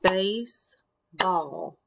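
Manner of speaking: BASE, pause, BALL; syllable by syllable. Shown as a demonstration. Teacher showing children syllable clapping, "Baseball" split in two. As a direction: say "Baseball" slowly in two separate beats. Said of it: "clap out parts of the words".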